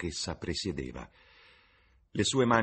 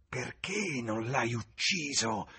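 put-on slow, higher hiss of a bitter old woman; hissed question; 6 semitones higher, slower than usual. A narrator's reading. Tavern woman hisses at James, slow and venomous. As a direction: spit it at him slowly. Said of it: "sibilò".

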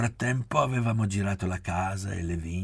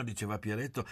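usual speaking voice

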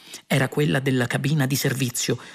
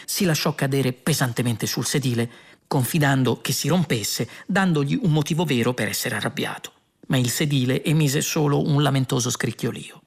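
normal talking voice

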